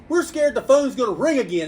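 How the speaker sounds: loud, high, mock-alarmed outburst; imitated panicky exit. Customers flee the shop before another call, loudly exasperated. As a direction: play the overwhelmed customer bailing out.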